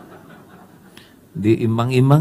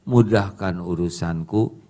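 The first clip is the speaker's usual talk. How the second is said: ordinary delivery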